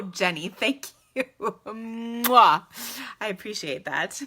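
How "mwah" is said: big smacking "mwah"; loud kiss noise. Real, not acted. Streamer thanking a viewer, big loud mwah. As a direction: blow a loud, drawn-out kiss.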